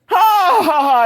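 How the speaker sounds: mock laugh. Mocking the laughing crowd.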